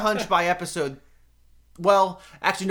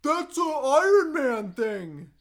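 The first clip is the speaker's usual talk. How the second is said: mock voice; 12 semitones higher, much slower than usual. Mock Iron Man voice after Avengers.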